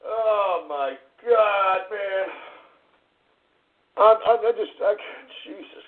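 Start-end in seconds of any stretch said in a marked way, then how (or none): none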